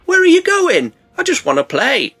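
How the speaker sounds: high, playful creature voice; put-on innocent plea; curious crocodile. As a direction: call out high like a puppy wanting a game. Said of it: "the croc is just curious, and it's all like".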